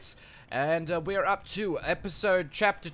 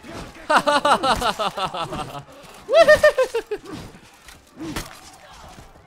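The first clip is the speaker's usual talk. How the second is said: high, loud villain cackle; evil cackle; 10 semitones higher, 9 dB louder than usual. Pretend. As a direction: cackle like a triumphant tyrant. Evil laugh after kills.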